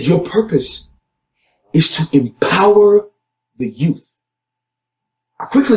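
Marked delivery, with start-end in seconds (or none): 0.0-4.2 a lightly voiced, higher divine voice speaking with calm authority; resounding clear voice. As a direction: announce it like a voice from heaven, clear and sure. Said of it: "the resounding and, and, clear voice".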